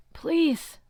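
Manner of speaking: wounded Luke begging Percy; groaned plea. A narrator's reading. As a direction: groan out please. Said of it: "Luke groaned".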